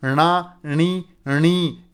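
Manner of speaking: slow णा and णी with the retroflex nasal held; syllable drill. Shown as a demonstration. Teacher sounding out ण syllables for the class, slowly. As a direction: stretch each ण syllable out. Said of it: "इस प्रकार उच्चारण किया जाएगा".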